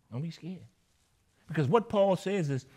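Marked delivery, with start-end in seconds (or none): 0.1-0.7 "Don't be scared" said again, softer and gentler; softer repeat. Shown as a demonstration. Preacher reassuring the church, softer. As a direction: ease off and reassure.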